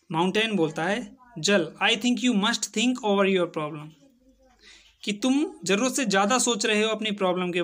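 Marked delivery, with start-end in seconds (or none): none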